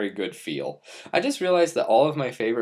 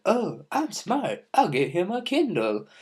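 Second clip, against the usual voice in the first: mock grandma voice; 9 semitones higher than usual. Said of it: "she's like".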